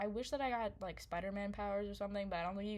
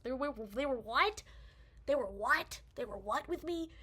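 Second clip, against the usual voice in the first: high, loud incredulous questioning; retold disbelief; 4 semitones higher, 4 dB louder than usual. Storyteller reacting to friend's news about pictures, incredulous. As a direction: repeat it as if unable to believe it. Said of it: "And I was like".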